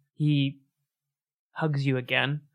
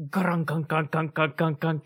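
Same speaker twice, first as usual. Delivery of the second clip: train clunking imitation; 3 semitones higher, slower than usual. Train sound again.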